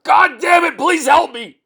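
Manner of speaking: screaming to God for help; desperate scream. Acted. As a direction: scream for help with all the breath you have. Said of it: "as I scream it out".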